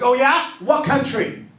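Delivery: teasing challenge. Retold or imitated. Wife calling out husband's lie, loud teasing rise. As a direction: catch him out with a sly oh yeah. Wife calls his bluff.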